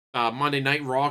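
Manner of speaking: ordinary delivery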